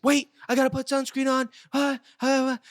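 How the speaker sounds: high-pitched frantic mom imitation; Megan. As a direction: mimic a fussing mother calling out to her kid. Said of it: "And Megan's like".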